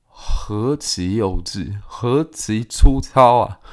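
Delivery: scornful slow verdict. Real, not acted. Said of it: "commentator's own scornful verdict".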